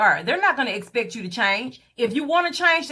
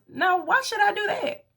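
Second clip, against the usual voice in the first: indignant refusal; 6 semitones higher than usual. Retold or imitated. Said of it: "my response was".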